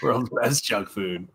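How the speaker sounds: World's best junk food repeated slowly and softly; slow, soft echo. Retold or imitated. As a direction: savor the catchphrase quietly. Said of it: "that's what she calls it".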